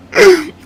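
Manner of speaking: loud high burst of laughter. Real, not acted. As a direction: crack up helplessly. Cracking up.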